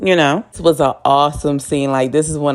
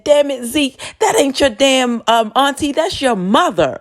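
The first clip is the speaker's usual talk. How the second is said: much higher, rude scolding; mock harsh Diana; 9 semitones higher than usual. Diana rudely telling Zeke the truth, high and harsh. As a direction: blurt it out with no mercy.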